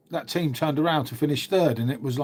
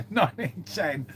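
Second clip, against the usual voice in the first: laughing; 3 semitones higher, slower than usual. Real, not acted. Laughing at rival's old title.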